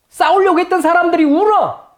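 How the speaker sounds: a high, awed exclamation; amazed exclaim. Real, not acted. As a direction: marvel aloud at what happened.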